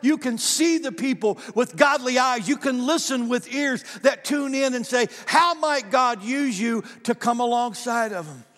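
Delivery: loud, high, ringing preacher's call to the congregation; full-force preaching. Acted. Pastor preaching hard to the congregation, loud and high. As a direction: preach it out big over the music.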